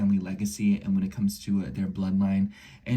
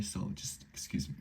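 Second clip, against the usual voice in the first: ordinary delivery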